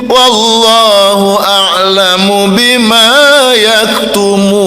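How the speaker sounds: slow, high melodic recitation; Quran recitation. Acted. Preacher reciting Ali Imran verse to congregation, slow and melodic. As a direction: draw out each phrase in tilawah.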